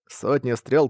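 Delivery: ordinary delivery, nothing marked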